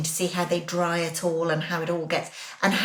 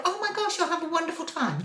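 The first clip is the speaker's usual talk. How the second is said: high-pitched, mock-gushing encouragement; gushing well-wisher; 9 semitones higher than usual. Telling her what people should just say, high and gushing. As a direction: imitate a friend cheering someone on, pitched way up.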